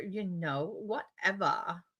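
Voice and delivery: slow, bored mock whatever; Valley Girl dismissive. Shown as a demonstration. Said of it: "Discord marker".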